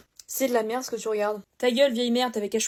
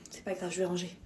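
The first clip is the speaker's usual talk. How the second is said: much softer, lower resigned reply; meek resignation; 6 semitones lower, 11 dB quieter than usual. Acted. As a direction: give in quietly. Résignation douce. (réponse résignée, très douce et basse)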